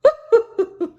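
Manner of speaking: high, loud hearty cow laugh. Acted. The friendly cow laughs at Blinky, high and loud. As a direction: burst into a big high laugh. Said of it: "The cow laughed".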